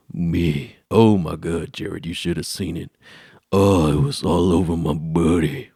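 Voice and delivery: slow, gushing brag; slow kid brag. Retold or imitated. Boy telling Jared about the slime, slow. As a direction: savor the story.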